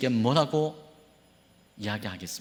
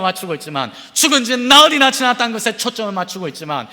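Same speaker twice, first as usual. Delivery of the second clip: rising, driving sermon build, louder each line; full-force preaching; 10 semitones higher, 7 dB louder than usual. Acted. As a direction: preach it higher with growing urgency. (점점 고조되는 설교)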